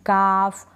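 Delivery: काफ with a long vowel straight into F; isolated model word काफ, L left out; silent L. Shown as a demonstration. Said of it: "silent है".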